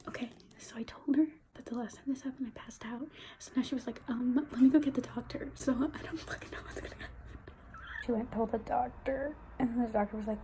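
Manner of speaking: very soft, low voice, partly whispered; hushed, subdued update. Real, not acted. Patient just after passing out from shots, hushed. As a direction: murmur it weakly, barely above a whisper.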